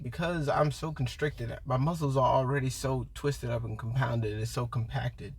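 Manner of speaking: usual speaking voice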